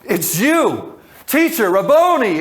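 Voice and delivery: joyful recognition. Retold or imitated. Mary recognizing Jesus, joyful cry. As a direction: burst out in recognition. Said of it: "Everything goes".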